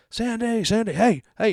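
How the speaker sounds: high, loud call of the dog's name. Retold or imitated. Owner calling his runaway dog by name. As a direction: call her across the street, voice way up.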